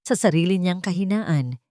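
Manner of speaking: plain reading voice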